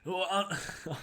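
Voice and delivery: mock TV caller gasp; a hint of a commentator voice; Elliot Loney impression. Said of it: "have a crack at what Elliot Loney does".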